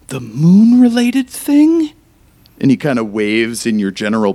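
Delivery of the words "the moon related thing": much higher, louder, drawn out slowly; high, slow, embarrassed blurt; blurted question. Acted. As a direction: stretch the awkward words out loud. Tobin blurts the secret question.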